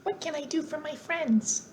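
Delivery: high, soft squeaky mouse queen; a second go at the tiny squeaky queen; squeaky little voice. A narrator's reading. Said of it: "in her squeaky little voice".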